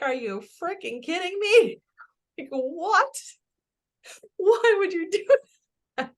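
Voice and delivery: high, loud alarmed protest with a laugh; freaked out. Retold or imitated. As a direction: squeal it in half-laughing panic. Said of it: "'cause I'm like".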